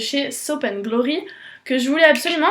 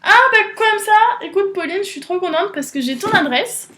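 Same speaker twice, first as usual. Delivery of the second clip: excited; 6 semitones higher, 3 dB louder than usual. Real, not acted. Thrilled talk to friend Pauline.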